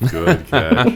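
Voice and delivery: high, loud mock praise. Podcast host teasing a co-host over her catch, squeaky mock praise. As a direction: squeak out a sarcastic good catch. Repeats own remark mockingly.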